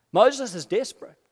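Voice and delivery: ordinary delivery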